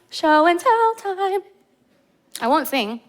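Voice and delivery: bright teasing show-and-tell call; playful announcement. Acted. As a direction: announce it like a kids' game host.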